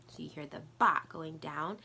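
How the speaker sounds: usual speaking voice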